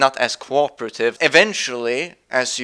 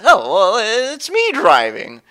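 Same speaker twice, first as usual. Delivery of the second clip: Goofy; 8 semitones higher, 5 dB louder, slower than usual. Acted. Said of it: "Goofy says".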